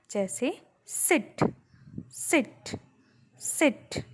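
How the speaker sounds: "सिट" said short and quick, the vowel kept brief; "सिट" demonstrated with a brief short i vowel; short i in sit. Shown as a demonstration. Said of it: "आपने सुना ना कितने देर में finish हो रहा है".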